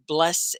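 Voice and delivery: BLESSED broken in two, "bless-ed"; two syllables. Shown as a demonstration. Teacher offers the other way to say the name, two syllables. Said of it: "Now, do you say your name".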